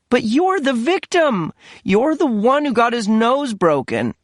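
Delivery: mother scolding her absent son; complaining mother. Acted. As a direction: pile on the guilt, aggrieved. Mother protesting.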